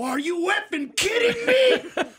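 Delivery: high, loud incredulous shout; hammed-up explosion of outrage; angry outburst. Comic reacts to her confession, angry incredulous shouting. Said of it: "Yes, I'm angry".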